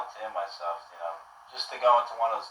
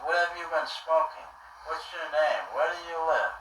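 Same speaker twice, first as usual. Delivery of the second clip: higher-pitched imitation of the grilling homeowner; suspicious homeowner; 6 semitones higher than usual. Homeowner grilling the soaked boy, in a raised mimicking voice. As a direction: ask it up high, mimicking a nosy, baffled man. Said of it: "He goes".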